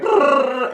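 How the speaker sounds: high rolled brrr; imitated whirring feet. Runner shows his feet going flat out, high trilled whirr. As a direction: trill it like feet racing on a treadmill. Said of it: "it's like my feet are".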